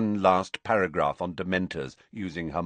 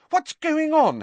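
shocked; 5 dB louder than usual. Acted. Hermione shocked.

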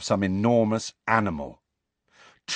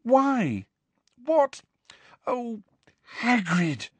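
near tears; 13 semitones higher, slower than usual. A narrator's reading. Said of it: "who sounded as though she wanted to cry".

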